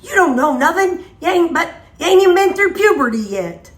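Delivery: high, loud, exasperated put-down; mock scolding. Vlogger telling off a kid-voiced assistant, mock scolding. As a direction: dismiss them loudly, pitch jumping up. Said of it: "I'll be like".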